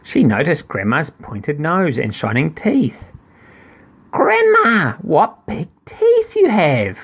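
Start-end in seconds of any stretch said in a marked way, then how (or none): 4.1-7.0 put-on girl amazed. Girl marvelling at grandma's ears, high and slow.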